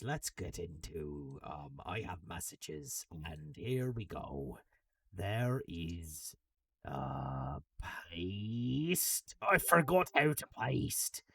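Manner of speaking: put-on low, slow pirate accent; idle pirate-accent muttering, pitched low. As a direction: talk like a pirate while fiddling with the computer. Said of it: "I don't know why I'm in a pirate accent".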